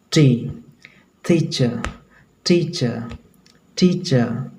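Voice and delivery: gentle T near the teeth in टी and टीचर; soft T drill. Shown as a demonstration. Teacher drills example word for students, soft T. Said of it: "मुलायम, कोमल sound आएगा".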